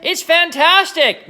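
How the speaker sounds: high, loud, slow, thrilled shout; excited shout. Acted. Mister Cranky dancing around the hen, a high thrilled shout. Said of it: "Mister Cranky shouted".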